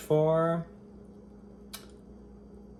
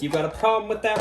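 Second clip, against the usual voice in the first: mock defiance; 7 semitones higher, 5 dB louder, much faster than usual. Defiant challenge to viewers.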